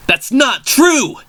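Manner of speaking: high, slow, strained yell. Acted. Zack yells a denial at the taunting villain, high and drawn out. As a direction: Zack yells back in outrage. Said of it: "yelled Zack".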